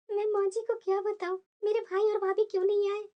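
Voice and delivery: soft, hushed aside to herself; whisper-soft, ashamed and stuck; helpless inner thought. Acted. Daughter-in-law's helpless inner thought. (बेबस धीमा मन ही मन)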